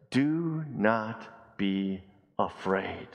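very slow, each word set apart; slow solemn quote. Acted. Preacher to congregation, slow solemn reading. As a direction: lay the words down slowly and gently. Preacher slowly voicing Jesus' words.

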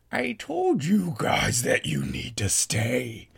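slow, menacing creature voice; sinister demonic old host; changed monster voice. Acted. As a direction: drag every word out as the host turns monstrous. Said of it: "As soon as her voice changed".

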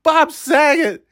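gasping at what Danny Tanner just said; shocked disbelief. Retold or imitated. Fan in the theater reacting in shock, high and stunned. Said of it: "that was the first time I'm like".

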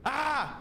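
short high-pitched scream; one sudden "ah" far above speaking pitch; quick scream to snap out of it; reset scream. Pretend. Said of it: "I gotta scream to reset it".

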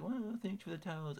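put-on high voice for a puzzled thank-you; imitating sister. Sister opening a Coke pack of towels, imitated politely.

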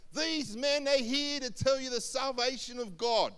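high, loud proclamation, held on one pitch; acted herald's cry; slave girl proclaiming. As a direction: call it out to the whole street. Slave girl crying out after apostles.

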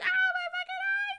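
a very high shrieking cry of pain; Mantis in agony. Acted. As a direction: scream as if blinded by acid. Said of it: "I don't know how to fucking voices".

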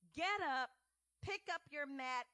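loud, firm command to rise; commanding call. Acted. Jesus commanding the paralyzed man, loud and firm. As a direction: order him up with authority.

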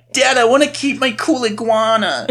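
high put-on child voice; mock plea from a kid to dad; pleading kid.

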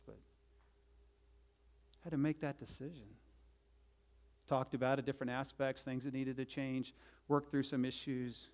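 ordinary talk, nothing marked

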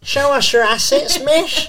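high, laughing Cockney schoolkid; Cockney teen. Retold or imitated. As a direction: jeer in a cheeky Cockney accent.

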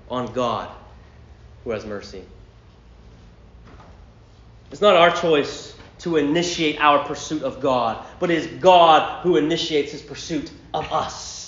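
ordinary talk

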